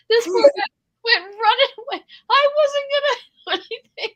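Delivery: ordinary talk, nothing marked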